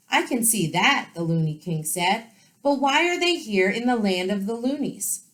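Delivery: lively read-aloud for children with voiced characters; story narration. A narrator's reading. Teacher reading a storybook to her students, lively. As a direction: read it brightly to the class.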